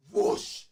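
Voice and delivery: loud, high whooshing blast; pitching a trailer, the bomb drops, whoosh; whoosh sound effect. Retold or imitated. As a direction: throw out a big explosion whoosh. Imitating incendiary bomb strike.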